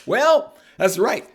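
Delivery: high-pitched mock amusement; amused buddy. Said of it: "my buddy starts laughing".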